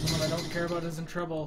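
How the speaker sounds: ordinary delivery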